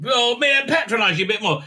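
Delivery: high, hammed-up condescending parody; mock patronizing. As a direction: send up someone talking down. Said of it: "there's just a lot of very patronizing talk".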